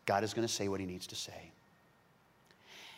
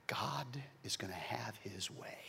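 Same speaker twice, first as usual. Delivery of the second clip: whispered, much softer; hushed whisper; 12 dB quieter than usual. Acted. Preacher whispers Isaac's realization to the church. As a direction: breathe it in awe.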